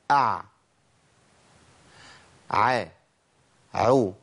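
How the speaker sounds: "أع، ع، عو" with the breath fully held back; ع without breath. Shown as a demonstration. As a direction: clear voiced ع, no air escaping. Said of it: "امنع النفس تمامًا".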